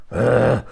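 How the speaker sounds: drawn-out throaty growl; possessed growl. Retold or imitated. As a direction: imitate a possessed woman growling. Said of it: "Bà cứ gầm gừ".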